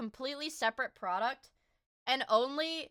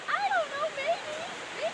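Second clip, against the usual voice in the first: high teasing voice; 4 dB louder than usual. Pretend. Teasing reply.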